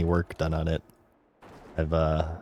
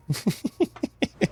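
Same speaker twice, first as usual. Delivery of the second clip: laughing. Real, not acted. Laughs at his own remark.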